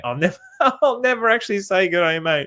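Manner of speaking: real chuckle mid-sentence; laughing. Real, not acted. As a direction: laugh through the words, amused at yourself. Cracks up after his mock greeting.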